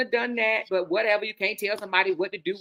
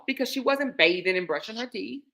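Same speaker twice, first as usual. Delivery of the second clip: normal talking voice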